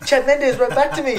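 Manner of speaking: giddy fan. Retold or imitated. Fighter telling his wife about the reply, in a high giddy voice. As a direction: gush like a starstruck kid. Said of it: "I'm like".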